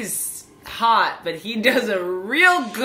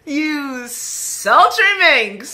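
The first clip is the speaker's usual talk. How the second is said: sultry teasing; 4 semitones higher, 4 dB louder, slower than usual. Real, not acted. Teasing a character.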